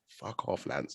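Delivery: under your breath at Lance; muttered curse. Retold or imitated. Reacting to Lance's story, voiceless muttered curse. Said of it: "I was just like".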